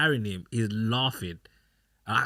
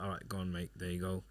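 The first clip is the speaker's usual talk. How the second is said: softer, low, offhand giving in; easygoing giving in; 3 semitones lower, 11 dB quieter, faster than usual. Retold or imitated. Dad caving to his toddler, softly indulgent. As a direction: give in quietly, low and easy.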